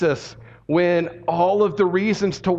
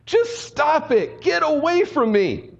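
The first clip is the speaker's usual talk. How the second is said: much higher anguished mock scream; 10 semitones higher, slower than usual. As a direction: Naomi blowing a gasket at the crowd. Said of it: "she jumps up and she screams".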